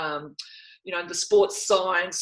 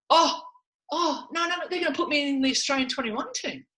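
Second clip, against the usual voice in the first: relieved; 8 semitones higher, faster than usual. Retold or imitated. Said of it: "and then I thought".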